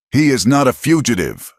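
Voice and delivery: high, loud retort; shouted back. Acted. Martha shouting back at the marshal, defiant. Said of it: "Martha shouted back".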